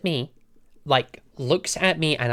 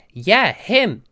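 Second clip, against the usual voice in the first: a higher, louder "Yeah, him!"; emphatic insistence; 6 semitones higher, 7 dB louder than usual. Retold or imitated. Shop assistant insists to her manager, emphatic. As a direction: blurt it with total certainty.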